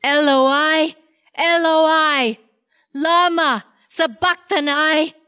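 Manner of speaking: high, anguished cry out; loud cry. Acted. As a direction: call out to God in agony. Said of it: "cried out in a loud voice".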